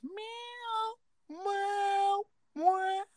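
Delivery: high mock cat meow. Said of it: "he always goes".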